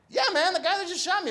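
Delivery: high, loud, rushed confirmation; emphatic insistence. Retold or imitated. Patient confirms to stunned medic, fast high insistence.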